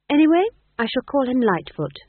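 high, loud and defiant; warm defiance. A narrator's reading. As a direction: announce it defiantly, pitched high. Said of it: "her voice warm with defiance".

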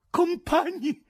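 high, loud frantic call; frantic high-pitched shout; frenzied yell. A narrator's reading. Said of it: "urlò frenetico il presidente".

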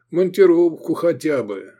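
high wishful lament; sighing regret. A narrator's reading. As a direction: sigh for it plaintively. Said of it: "завздыхал Исмат".

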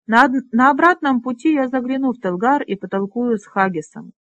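plain reading voice

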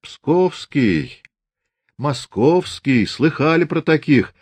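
mock the prisoner's origin. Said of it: "насмешливо сказал Фомин".

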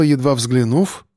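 usual speaking voice

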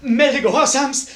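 high, slow, ringing proclamation; joyful blaring announcement. Acted. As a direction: blare it out to the whole hall. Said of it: "in den erwartungsvollen Saal schmettert".

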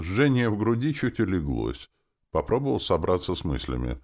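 usual speaking voice

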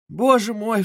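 high, loud exclamation; alarmed mother's exclamation; shocked cry. A narrator's reading. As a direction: cry out in dismay. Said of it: "вскричала Пульхерия Александровна".

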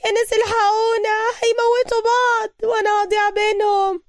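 high, frantic cry for help; hysterical screaming. A narrator's reading. Ibtisam calls the neighbours for help, high hysterical cry. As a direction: shriek for help from anyone. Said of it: "وظلت تنادي بهستيريا وهي تصرخ".

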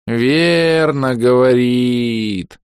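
высокий медленный блеющий старик; проблей, растягивая слова; блеющий дед. A narrator's reading. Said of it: "проблеял призрачный дедушка". (блеющий старый призрак, очень медленно)

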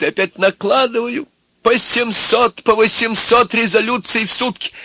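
overwrought complaint. Acted. Old man complains of endless resolutions, overwrought. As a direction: pile up the burden in rising exasperation.

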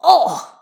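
loud, high "oh" of protest; protesting yelp. A narrator's reading. Skater hits the floor, yelping in protest. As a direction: yelp as you hit the floor. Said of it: "protests as he hits the floor".

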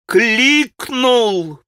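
high, sharp cry; pained shout. Acted. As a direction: shout it through a sick smile. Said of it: "прокричала она вся бледная". (болезненный выкрик)

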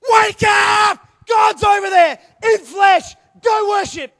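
loud, high shouting wake-up call; urgent shout. Retold or imitated. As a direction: yell at the sleepers to get up. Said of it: "Shepherds go".

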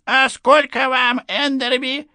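put-on high, slow old lady asking; curious old woman, high-pitched; elderly lady voice. Old woman's question at the party. (высокий голос старушки)

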